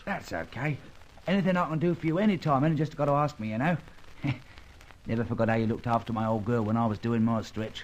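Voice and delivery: friendly Cockney, eager to help; grateful London Cockney with a chuckle; Cockney accent. Acted. Old crook thanking his friend, in Cockney.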